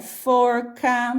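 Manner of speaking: "forca" slowly, stretching the R before "ca"; R puxadinho. Shown as a demonstration. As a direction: draw out the R in "forca". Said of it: "a gente dá uma puxadinha". ("forca" devagar, com o R puxado)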